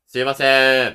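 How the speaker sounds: very loud, sustained call; full-voiced demonstration hail; open-throated projection. Shown as a demonstration. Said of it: "喉を開いてやってるね".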